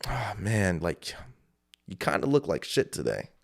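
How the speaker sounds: low, quiet inner-voice murmur; inner critic voice. Acted. Inner voice puts the speaker down, low and quiet. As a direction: play the discouraging voice in your head, softly. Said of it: "that little voice said".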